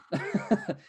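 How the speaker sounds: loud high laugh; burst of laughter. Real, not acted. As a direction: laugh out at the memory. Laughs at own accent story.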